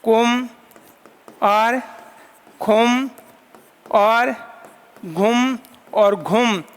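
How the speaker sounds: कुम, खुम, घुम each said very slowly with long pauses between; slow, spaced recital. Shown as a demonstration. Teacher slowly reciting the four yamas for students. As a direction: spell out कुम खुम घुम one syllable at a time for students. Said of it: "यम वर्ण कितने पढ़े थे वहाँ हमने?".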